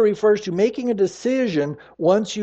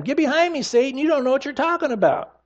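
a hint of a stern Jesus, pitched higher; mild stern rebuke; 7 semitones higher, faster than usual. Jesus rebuking Peter, lightly voiced sharp rebuke. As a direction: rebuke him quickly and firmly.

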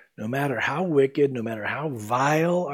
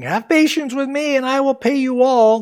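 high, louder pleading servant; begging servant; 10 semitones higher, 7 dB louder than usual. Acted. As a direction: plead for mercy on your knees. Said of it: "He fell at his feet and begged him".

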